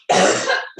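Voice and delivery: harsh coughing fit from spice; choking cough. Real, not acted. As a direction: cough hard, throat burning. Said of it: "spice in my throat".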